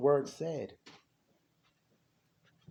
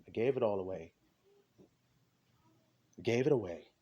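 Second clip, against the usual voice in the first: normal talking voice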